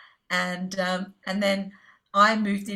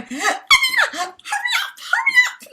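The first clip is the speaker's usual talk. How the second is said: urgent, rising much higher and louder to a squeal; excited calling; 8 dB louder than usual. Retold or imitated. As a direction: hurry her along, bursting with excitement. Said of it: "Like, she was so excited".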